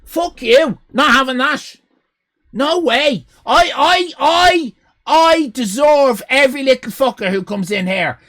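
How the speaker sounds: shouting in hammed-up indignation; mock outrage. Real, not acted. Streamer shouting back at a rude viewer in mock fury. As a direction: yell it like a wounded showman. Streamer rants at chat insult.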